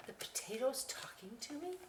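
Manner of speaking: hushed unvoiced astonishment; amazed whisper. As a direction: whisper it wide-eyed.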